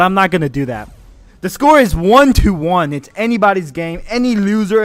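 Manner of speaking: loud, excited caster calls; hyped play-by-play. Acted. Streamer casting his friends' match, excited play-by-play. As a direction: call every play like a shoutcaster.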